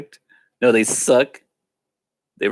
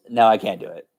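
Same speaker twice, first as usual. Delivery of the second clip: usual speaking voice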